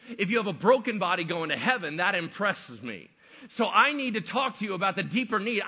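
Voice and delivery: full-force preaching. Acted. Jesus pressing the paralyzed man on forgiveness, preaching loudly. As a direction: thunder it out like a revival pulpit.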